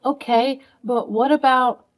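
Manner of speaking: ordinary talk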